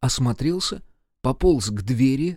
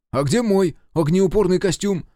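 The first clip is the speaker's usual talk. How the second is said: loud, raised indignant challenge; cutting retort; 7 semitones higher, 5 dB louder than usual. A narrator's reading. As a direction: throw the question back at Grandpa sharply. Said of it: "срезал Серёжа". (резко срезать)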